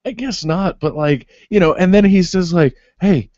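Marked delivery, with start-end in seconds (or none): 3.0-3.3 lightly hammed-up fake Texan tough-guy line; mock bad Texas accent. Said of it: "the most non-Texas accent I've ever heard".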